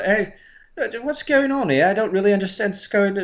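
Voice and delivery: lilting imitation British; put-on British accent. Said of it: "I like more of the".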